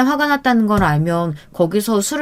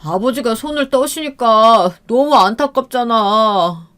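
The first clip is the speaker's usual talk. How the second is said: slow, higher, teary pleading; tearful drunk whine; 3 semitones higher than usual. Acted. Drunk husband excuses himself to wife, tearfully. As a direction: whine it like he's about to cry. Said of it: "갑자기 우는 게 아니겠어요".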